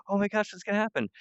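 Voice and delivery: high, quick, anxious anticipation; excited audience. Acted. Audience wondering about the Green Wolf robot, excited. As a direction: gush like a viewer in suspense. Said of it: "the audience is, like, really".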